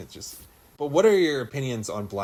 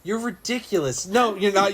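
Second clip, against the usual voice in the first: incredulous; 6 semitones higher than usual. Real, not acted. Disbelief at a joke.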